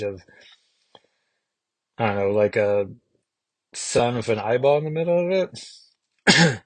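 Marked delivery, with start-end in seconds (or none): none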